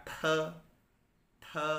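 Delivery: "te" said as a puffed t-h sound; aspirated T. Shown as a demonstration. Teacher showing how pinyin T is read, aspirated. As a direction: say "te" with a burst of breath after the t.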